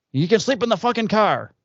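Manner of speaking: high-pitched imitation of an irritated old man; exasperated old father. Old father banishes the kid to the car, high mock exasperation. Retold grudging order of Greek father.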